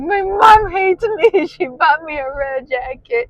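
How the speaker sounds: high, slow, loud mock wail of a sulky twelve-year-old; mock whiny kid. Retelling her sulk over the red jacket, high mock wail through laughter. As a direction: wail it like a kid who feels unloved. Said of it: "I was like".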